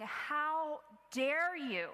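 slow, drawn-out mock indignation; fuming how dare you. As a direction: stretch each word, inwardly seething. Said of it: "I just was thinking and fuming".

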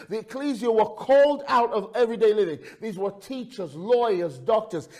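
high, loud, impassioned sermon voice; soaring, emphatic pulpit delivery; full-force preaching. Real, not acted. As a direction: proclaim it to the whole congregation.

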